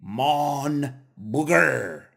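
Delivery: "Mon booger" drawn out very slowly in a mock French accent; slow demo. Shown as a demonstration. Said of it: "you would say".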